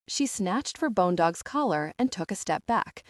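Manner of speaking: ordinary delivery, nothing marked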